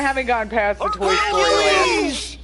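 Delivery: usual speaking voice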